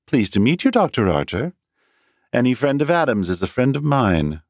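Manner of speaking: lightly voiced charming young woman; friendly woman. A narrator's reading. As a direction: greet him with a smile. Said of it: "a smile lighting her large eyes".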